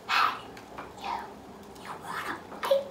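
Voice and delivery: quiet unvoiced sing-along; whispered line. Shown as a demonstration. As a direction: softly invite the kids to join in. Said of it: "Can you say it with me".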